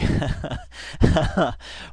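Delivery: breathy stifled laugh; laughing helplessly at the clip; burst of laughter. Real, not acted. Reacting to the video, breathy stifled laugh.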